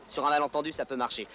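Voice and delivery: ordinary delivery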